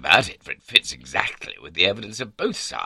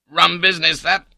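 higher, louder husband's voice; husband's remark; 12 semitones higher, 8 dB louder than usual. A narrator's reading. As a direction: pitch it up and out across the table. Husband opens talk over breakfast.

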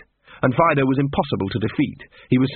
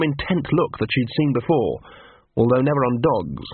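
usual speaking voice

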